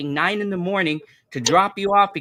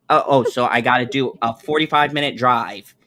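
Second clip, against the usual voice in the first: normal talking voice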